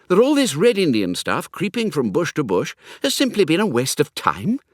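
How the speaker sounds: lightly voiced young toff, urgent and conspiratorial; eager young Hugo.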